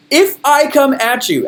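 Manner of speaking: high-pitched, drawn-out attack; high energy. Shown as a demonstration. Speaker showing the audience his style, high-energy delivery. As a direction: come at them with big energy. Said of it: "I'm kinda high energy".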